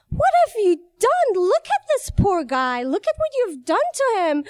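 high, dramatic mock scolding; guilty self-reproach. Woman berating herself over the scared driver, guilty.

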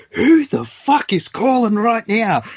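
dad shouting high and loud, outraged; furious yell. Retold or imitated. Dad interrupted during his show yells at the ringing phone, high furious shout. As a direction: bellow it like an old man whose TV show got interrupted. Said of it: "he's like".

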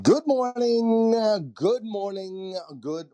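loud high hype greeting, fading; excited greeting. Real, not acted. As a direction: boom out the morning greeting then settle. Host opens segment, hyped greeting.

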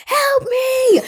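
put-on high, slow, terrified little-girl wail; imitating a screaming child. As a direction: squeal it like a scared kid who sees a ghost. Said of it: "she started screaming".

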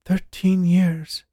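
slow, high stunned disbelief; a woman learning how long she was dead. A narrator's reading. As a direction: let it sink in slowly. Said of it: "she uttered in disbelief".

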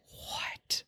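airless whispered what; shocked whisper. Retold or imitated. Reacting to the stranger's intro, whispering in disbelief. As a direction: breathe it out in shock.

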